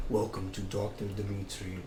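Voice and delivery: put-on low, hushed Australian accent like an ASMR host; deep Australian accent. Imitating a YouTube ASMR doctor, low Australian accent. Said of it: "he has, like, a deep Australian accent".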